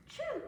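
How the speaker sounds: gasp, then high, loud "choo"; mimed sneeze. A narrator's reading. Storyteller as the cat catching cold, mimed high sneeze. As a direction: act out the cat's sneeze. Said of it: "the sneeze".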